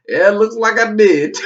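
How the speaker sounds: laughing reply. Retold or imitated.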